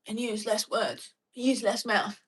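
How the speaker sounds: Cockney squished down into flat Australian; flattened Australian accent. Shown as a demonstration. Explaining Australian speech to viewers, flattened Australian accent. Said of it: "if you squish it down".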